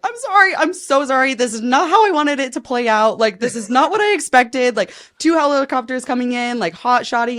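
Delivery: high, loud retold panicked apology; frantic apology. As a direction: blurt out an over-the-top sorry, pitch way up. Acted frantic apology on the call.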